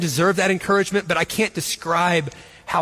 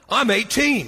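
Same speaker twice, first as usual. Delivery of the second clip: put-on defiant teen; 4 semitones higher, slower than usual. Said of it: "And he goes".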